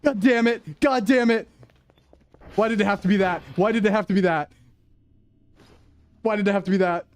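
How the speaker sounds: high-pitched rattled complaining; shaken frustration. Real, not acted. Streamer shaken after the alien scare, complaining. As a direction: vent the fright still in the voice.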